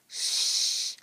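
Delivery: long hissed shush. A narrator's reading. Ian hushing his friends, long shush. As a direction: hush them for the show.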